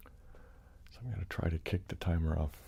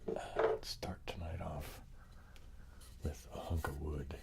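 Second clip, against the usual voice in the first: soft slow unvoiced whisper; ASMR whisper; 6 dB quieter, slower than usual. Real, not acted. Whispering to stream viewers before tapping. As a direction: hush it like an ASMR intro.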